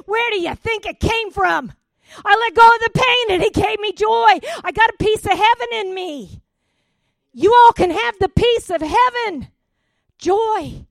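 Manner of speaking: high, shouted revival proclamation; full-force preaching. Real, not acted. Telling the church where her joy came from, shouted preaching. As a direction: belt it out jubilantly to the congregation.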